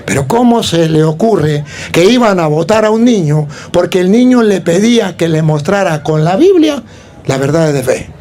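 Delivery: ordinary talk, nothing marked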